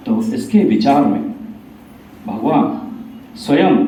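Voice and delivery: ordinary delivery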